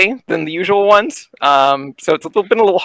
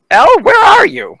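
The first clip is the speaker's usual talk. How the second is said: high caller voice; 14 semitones higher, 5 dB louder than usual. Acted.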